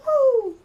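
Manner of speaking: high, loud "woo"; sudden high-pitched holler; mock whoop. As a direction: let out a mock cheering whoop. Imitating what the posse would cry.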